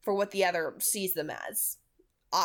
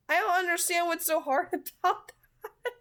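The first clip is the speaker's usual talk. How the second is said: laughing disbelief; 11 semitones higher, faster than usual. Real, not acted. Mocking Bella's goldfish failure.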